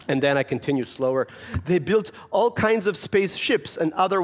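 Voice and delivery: put-on a little Russian boy reading his story; Russian child reading aloud. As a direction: read like a proud boy with a thick accent.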